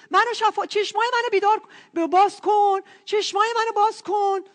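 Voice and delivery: high, loud begging; urgent imagined plea. Retold or imitated. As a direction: plead loudly for healing, pitched well up. Voices the plea he expected.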